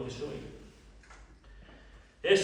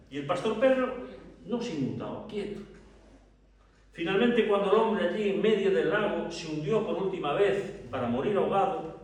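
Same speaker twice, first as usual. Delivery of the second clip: normal talking voice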